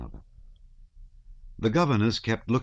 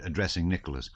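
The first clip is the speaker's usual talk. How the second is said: ordinary delivery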